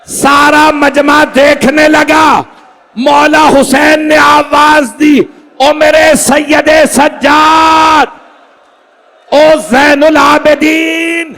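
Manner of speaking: lamenting high and loud, drawn-out cries; full-force preaching. Acted. Preacher to the mourning crowd, in a high, loud, drawn-out lament. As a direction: wail it out to the mourners, building to a peak. Majlis climax, preaching the farewell.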